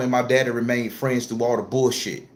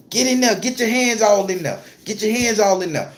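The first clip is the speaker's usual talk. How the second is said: yelled order; 7 semitones higher than usual. Retold or imitated. Grandma orders the crying boy into the pot, yelling. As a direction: force the kid's hands in.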